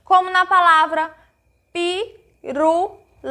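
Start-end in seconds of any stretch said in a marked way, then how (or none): 1.7-3.3 "pirulito" broken into pi, ru, li, to with clear pauses; syllable by syllable. Shown as a demonstration. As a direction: say "pirulito" one separate syllable at a time. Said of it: "toda vez que a gente abre a boca". ("pirulito" dito sílaba por sílaba, com pausas)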